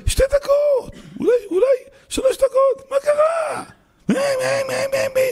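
very high squeaky mimicry; mock squeal. Congregant fussing over a few extra minutes, mock squeal. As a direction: squeal it in a tiny, very high mocking voice.

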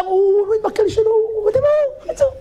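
hammed-up tearful rabbi; mock panicked rabbi. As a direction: whine the blame like a panicked rabbi. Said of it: "אפילו הרב יפה אומר".

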